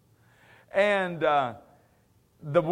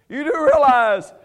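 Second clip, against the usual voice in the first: louder and higher, building to a peak; preaching climax; 8 semitones higher, 8 dB louder than usual. Real, not acted. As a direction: drive the point up to fear.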